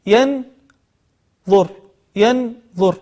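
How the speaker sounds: ينظر broken after a clear n, pause, then the second syllable; word split at noon. Shown as a demonstration. Teacher pointing out the silent noon, ينظر said syllable by syllable. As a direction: split ينظر into two separate syllables, yan, dhur. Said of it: "نون ساكنة".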